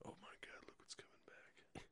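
hushed off-mic whisper. Real, not acted. Off-mic aside while song starts.

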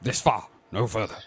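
low, measured Picard voice; a light Star Trek captain voice; Picard impression. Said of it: "it's your Picard voice".

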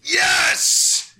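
loud delighted outburst; excited shout. Real, not acted. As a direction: shout with joy. Delighted by Marzipanda.

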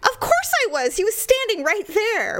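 high, insistent retort; emphatic protest. Real, not acted. Answering a friend's doubting question about her husband, emphatic protest. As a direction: protest that it's completely obvious.

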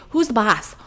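bossy mom. Retold or imitated. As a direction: lay down the law to a toddler. Acting out quarrel with toddler.

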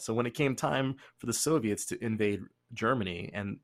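ordinary delivery

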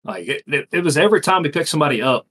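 normal talking voice

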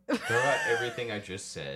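laughing while talking. Real, not acted. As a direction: say it through a laugh. Laughing while retracting advice.

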